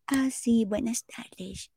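slow whispered prim greeting; prim soft greeting. Shown as a demonstration. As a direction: hush it like a proper young lady. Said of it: "tengo que haber así de". (saludo susurrado de señorita)

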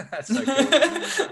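high delighted laugh through words. Real, not acted. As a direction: gush with laughter. Host laughs at gorilla story.

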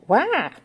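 high-pitched yelp of "wah"; sudden cry. A narrator's reading. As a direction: blurt out a short, high cry. Startled cry on the phone.

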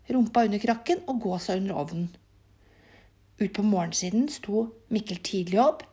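plain reading voice

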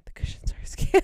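imitating mom's insistent stage whisper. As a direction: whisper it hard enough to be overheard. Mom repeats her aside, louder.

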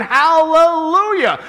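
high, long drawn-out cry of praise; thunderous shouting. Acted. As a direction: roar it out like a great multitude. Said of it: "like the loud peals of thunder".

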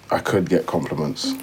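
ordinary delivery

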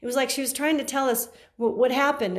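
ordinary talk

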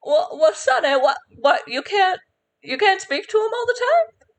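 put-on high, quavering old-lady question; old woman voice. Old shopkeeper questions the young druid, high and quavering. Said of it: "she says".

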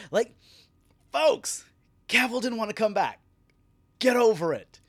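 high, loud yell at the fans; exasperated shout. Real, not acted. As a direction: shout it, fed up with them. Ranting at fans on his show.

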